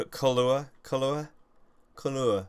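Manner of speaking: colour stretched toward "cuh-loo-er"; French-style colour. Shown as a demonstration. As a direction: say colour with an oo in the second syllable. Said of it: "It's French".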